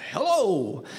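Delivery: imitating a high, hearty hello; big cordial greeting swooping up high. Father opening the door to a visitor, cordial. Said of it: "with this ra-, rather cordial tone".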